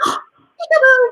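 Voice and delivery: gasp, then high loud peekaboo; surprised. Acted. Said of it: "'cause you're surprised".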